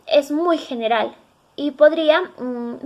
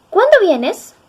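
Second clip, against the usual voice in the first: "¿Cuándo vienes?" asked as a real question, pitch lifted higher; question intonation; 4 semitones higher, slower than usual. Shown as a demonstration. Teacher shows students the intonation of a direct question. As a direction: ask "¿Cuándo vienes?" with a clear questioning tune. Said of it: "llevan signos de interrogación, tilde y, y entonación".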